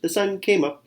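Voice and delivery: high, light chirpy squirrel voice; chirping squirrel. Acted. Squirrel gloating at the sunrise, high chirpy voice. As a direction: chirp it like a happy little squirrel. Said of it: "he chirped".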